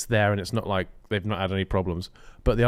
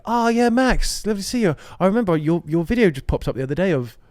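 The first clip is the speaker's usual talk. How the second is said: fake friendly gush; 8 semitones higher, 4 dB louder than usual. Mock fake-friendly influencer chat.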